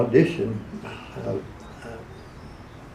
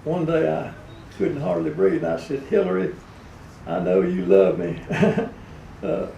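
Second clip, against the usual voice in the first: Bill Clinton; 4 semitones higher than usual. Acted. Ailing president asking his wife a favor, Clinton impression. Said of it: "Bill Clinton was in his heyday".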